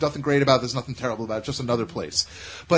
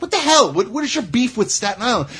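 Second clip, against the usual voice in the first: mock outrage; 6 semitones higher than usual. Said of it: "he's like".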